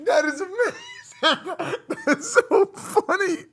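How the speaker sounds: high, laughing reaction, words breaking up; laughing hard. Real, not acted. Streamer cracking up at their own Persian ad, laughing hard. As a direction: barely get it out through real laughter. Reacting to funny played clip.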